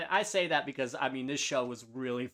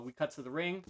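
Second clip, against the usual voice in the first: ordinary talk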